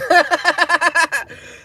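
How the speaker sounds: a loud, high, real laugh bursting out; high burst of laughter. Real, not acted. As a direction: let the laugh explode at your own punchline. Laughing at own punchline.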